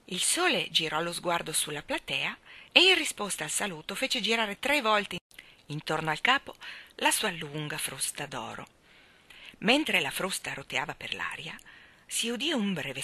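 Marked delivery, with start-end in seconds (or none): none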